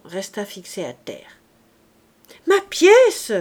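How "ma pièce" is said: put-on high, loud cry of a child; child's cry. A narrator's reading. Little Savoyard crying out to Jean Valjean for his coin, loud high cry. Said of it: "cria l'enfant".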